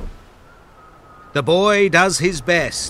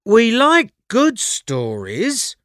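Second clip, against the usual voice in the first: put-on young boy voice; 8 semitones higher than usual. A narrator's reading. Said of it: "Luke says".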